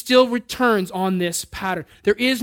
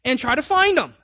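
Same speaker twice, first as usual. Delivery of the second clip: a hint of an exasperated God, rising louder; lightly voiced God scolding a knucklehead, building higher; voicing God, mildly fed up with the believer; God's voice; 5 semitones higher, 4 dB louder than usual. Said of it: "God's like".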